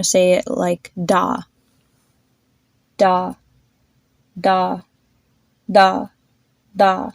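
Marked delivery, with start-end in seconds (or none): 3.0-7.1 "da" repeated, the d lighter each time; softening consonant. Shown as a demonstration. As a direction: shrink the puff of air on each "da". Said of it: "each time we're gonna try to make it more softer".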